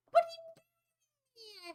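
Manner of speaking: drawn-out, very high mock wail; hammed-up high whine; high-pitched protest. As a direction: squeal it slowly in exaggerated disbelief. Protesting about failing statistics.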